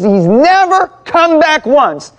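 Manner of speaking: very high, slow, wounded complaint; aggrieved outburst. Retold or imitated. Wounded woman complaining to pastor about no apology, aggrieved. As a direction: imitate a hurt woman, stretching words at high pitch. Retold woman's grievance peaks.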